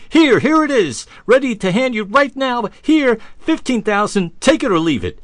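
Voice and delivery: high, loud and breathless, pushing the check at him; an eager buyer who can't wait to close the deal; frantic stranger. Retold or imitated. Stranger shoves the check at the inventor, frantic and high-pitched. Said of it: "The stranger frantically finishes writing the check".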